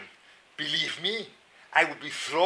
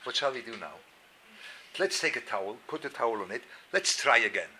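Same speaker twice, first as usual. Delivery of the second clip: soft, unbothered, gently soothing; calm wife; 4 semitones lower, 7 dB quieter than usual. Retold or imitated. Wife calmly comforting daughter over spilled soup. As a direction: shrug it off calmly and kindly. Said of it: "so she looks at the thing and says".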